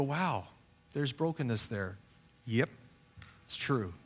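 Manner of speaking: ordinary talk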